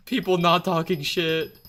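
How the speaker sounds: delighted squeal. Real, not acted. Streamer squealing happily at supportive chat, very high and loud. Chat praises his perk.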